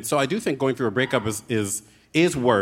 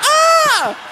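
laughing shriek; 8 dB louder than usual. Real, not acted. Shocked laughing scream.

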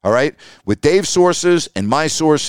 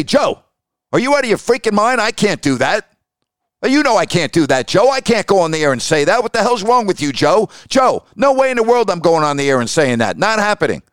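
raised-pitch, exasperated pushback; heated refusal; 7 semitones higher than usual. Retold or imitated. Host refusing the owner on the phone, high exasperated pushback. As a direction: push back hard, voice up, incredulous.

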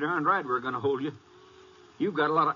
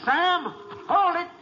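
much higher, louder yell to stop; shouting after him; 5 dB louder, much slower than usual. Acted. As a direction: yell across the yard to make him halt. Sheriff calls to fleeing suspect.

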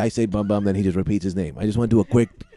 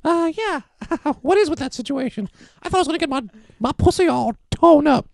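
much higher put-on woman's voice; 6 semitones higher than usual. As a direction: falsetto lady character. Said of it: "I end up talking like this".

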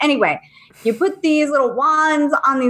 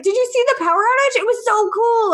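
put-on much higher, fast thrilled kid; excited kid; 11 semitones higher than usual. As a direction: son raving about the outage. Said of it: "And Riley's like".

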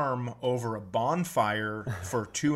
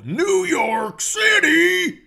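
long, high, drawn-out yell of the city; hammed-up shout; 11 dB louder, much slower than usual. Comedian touring cities, yelling the last name. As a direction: belt it like an arena announcer.

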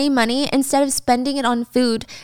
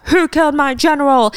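pissy mock outburst, higher pitched; 4 semitones higher, 4 dB louder than usual. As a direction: play an offended lord demanding answers. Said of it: "sweeps into town all pissy".

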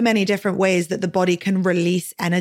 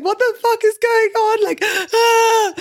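much higher and louder, a mock shocked yell; retold bewildered outburst; 5 dB louder, slower than usual. Recalling her confusion at crying after sex, a high mock shout. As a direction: replay the baffled panic as a near-shout. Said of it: "And I was like".

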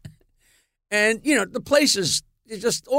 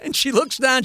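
normal talking voice

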